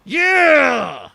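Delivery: real victory yell, high and very loud; loud, high-pitched cheer; triumphant shout. Real, not acted. Cheers on beating the challenge.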